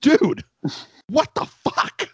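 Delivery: high pitched, stunned cry of disbelief; squeaky, whispered shocked what the fuck. Retold or imitated. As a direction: react like you can't believe what you heard. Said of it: "And I'm just like".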